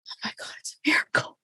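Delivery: whispered, breathless with awe; awed whisper. Retold or imitated. Mom-to-be whispering in awe as her labor starts. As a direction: hush it like a small miracle.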